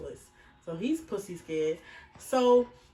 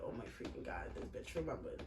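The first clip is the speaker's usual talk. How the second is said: hushed panic; 11 semitones lower, 12 dB quieter than usual. Retold or imitated. Her own panicked inner reaction.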